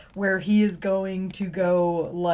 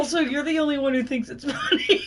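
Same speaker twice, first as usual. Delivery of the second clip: higher pitch, breaking into a laugh; 7 semitones higher than usual. Real, not acted. Co-host mocking Matt's private joke, laughing. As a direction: crack up as you say it.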